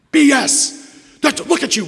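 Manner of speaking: furious high shout; screaming. Retold or imitated. Sponsor screaming at newcomer, full loud shout. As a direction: scream it right in his face. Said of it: "he starts screaming at me".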